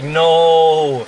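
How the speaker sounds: long drawn-out mock "no"; retold dismayed cry. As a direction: draw it out in hammed-up dismay. Retold waking from the nightmare.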